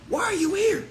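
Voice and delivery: high mocking why are you here; incredulous taunt. Real, not acted. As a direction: squeak it up in disbelief. Mocking question to doubters.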